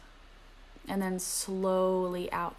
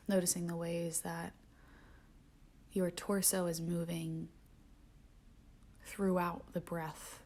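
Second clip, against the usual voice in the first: softer meditation voice sinking to whispers; guided meditation; 7 dB quieter than usual. Acted. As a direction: guide the breath, letting phrases fade to a whisper. Returns to guided breathing.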